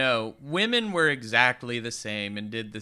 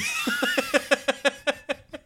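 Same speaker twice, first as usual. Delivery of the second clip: very high, drawn-out laughing fit; real high-pitched giggling fit; helpless laughter. Real, not acted. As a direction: crack up at your own slip. Realizes they forgot the opening.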